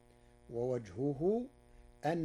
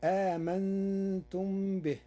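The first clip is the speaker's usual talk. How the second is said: slow, higher chanted آمنتم به; slow Quran recitation; 4 semitones higher, 4 dB louder, slower than usual. Shown as a demonstration. Reciter quotes the verse: slow tajwid chant. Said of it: "قرأ ورش كلمة آمنتم".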